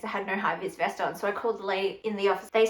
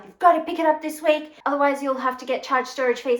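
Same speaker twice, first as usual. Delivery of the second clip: higher, louder voice as a pushy shipping agent; 4 semitones higher, 3 dB louder than usual. Retold or imitated. Shipping company pressing her to pick up, mock pushy. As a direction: parrot the company's demand, a bit higher.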